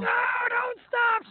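high, slow whiny plea; mock crowd plea. Crowd begging the wife to keep playing, high drawn-out whine. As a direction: beg her like a disappointed crowd. Crowd booing her for quitting.

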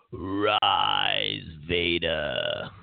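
low, slow Darth Sidious rasp; Emperor impression. Emperor commanding Vader, deep and menacing. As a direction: intone it like the evil Emperor. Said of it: "He goes".

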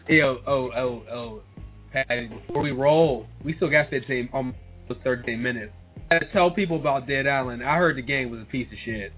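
ordinary delivery

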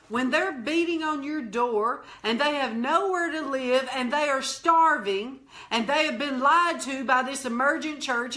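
impassioned pulpit exhortation; forceful preaching. Real, not acted. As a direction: build from punched commands into a driving, urgent tirade.

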